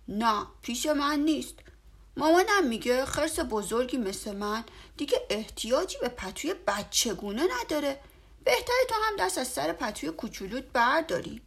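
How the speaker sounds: put-on a know-it-all young bear; bear friend. A narrator's reading. Bear lectures his friend about baby blankets. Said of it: "خرس گفت".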